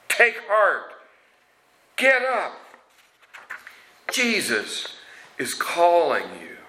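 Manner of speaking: high encouraging call. Retold or imitated. Crowd urging the blind beggar toward Jesus, high encouraging call. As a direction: cheer him to his feet.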